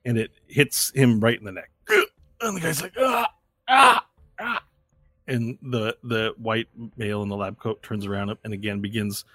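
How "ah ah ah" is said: high, choked cries of pain; short high yelps; pained gasps. Acted. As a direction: cry out as if shot. Shot guard cries out.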